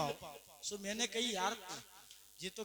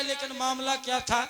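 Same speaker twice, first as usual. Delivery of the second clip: ordinary talk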